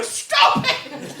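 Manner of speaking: ordinary talk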